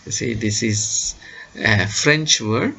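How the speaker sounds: normal talking voice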